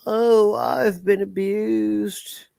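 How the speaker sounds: high, slow, whiny mock of a victim. Streamer mocking Jolly's victim act, slow high whine. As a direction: drag it out like a self-pitying martyr. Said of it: "And Jolly is the".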